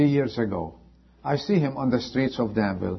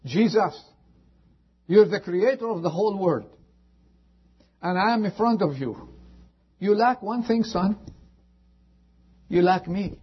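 higher, earnest plea to Jesus; pleading prayer voice; 8 semitones higher than usual. Acted. Preacher voicing a seeker praying to Jesus, fervent plea.

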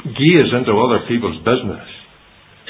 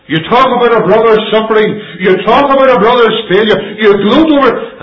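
loud, higher, slowed preaching; full-force preaching; 11 semitones higher, 9 dB louder than usual. Acted. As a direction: preach it out to the hall.

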